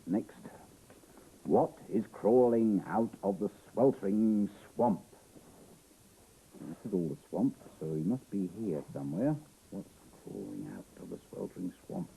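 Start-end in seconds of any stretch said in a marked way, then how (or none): 1.4-5.0 slow, emphatic storytelling question; dramatic story reading. A narrator's reading. Reader puts the book's riddle to young listeners, dramatic and slow. 9.7-12.1 low, soft wondering question; hushed repeat. A narrator's reading. Reader searches the swamp page with the child, hushed and low.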